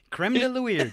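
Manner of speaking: repeating it while laughing. Real, not acted. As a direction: laugh through the pun.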